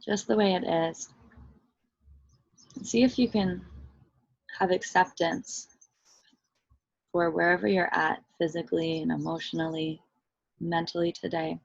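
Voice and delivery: soft, unhurried meditation voice with long pauses; guided meditation. Real, not acted. Teacher leading listeners into body and breath, guided meditation. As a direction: guide them gently inward, softly and evenly.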